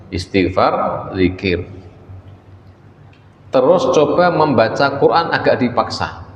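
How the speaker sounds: ordinary talk, nothing marked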